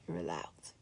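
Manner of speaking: tender whisper of "You're allowed"; whispered reassurance. Real, not acted. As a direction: breathe "allowed" out as a soft whisper.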